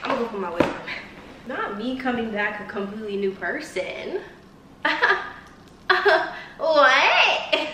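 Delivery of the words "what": laughing. Real, not acted. Laughing at herself.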